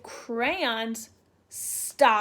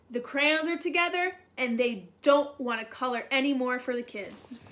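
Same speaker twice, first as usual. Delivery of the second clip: usual speaking voice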